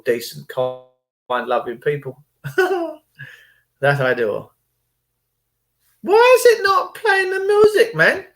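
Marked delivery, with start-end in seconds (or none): 2.4-2.9 high laugh. Real, not acted. Laughing at own line. 6.0-8.2 much higher, puzzled complaint; exasperated. Real, not acted. Vlogger annoyed the music won't play, much higher. Frustrated tech trouble.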